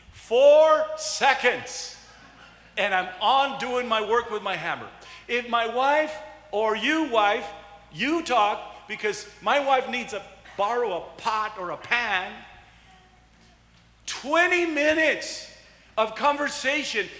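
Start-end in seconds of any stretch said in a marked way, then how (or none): 0.1-2.0 Four seconds drawn out slow and loud; emphatic slow punchline; stress on four seconds. Real, not acted. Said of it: "emphatic punchline". 14.1-15.5 twenty minutes stretched high and slow; exaggerated long stretch; stress on twenty minutes. Real, not acted. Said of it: "contrast punchline".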